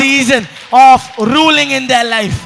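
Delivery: slow, shouted decree; loud, ringing blessing; forceful proclamation. Real, not acted. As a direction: stretch every word out at full preaching power. Preacher proclaims at full force.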